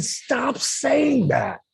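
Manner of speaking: high, slow, drawn-out plea to stop; firm pleading. Retold or imitated. Friend begging Jake to stop insulting himself, slow and high. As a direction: stretch it out, begging him to quit.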